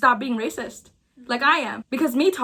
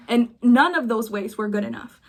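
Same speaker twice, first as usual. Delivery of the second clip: normal talking voice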